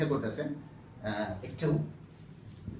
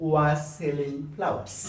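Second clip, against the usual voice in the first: slow reading; 3 semitones lower, much slower than usual. Shown as a demonstration. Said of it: "reading example sentence".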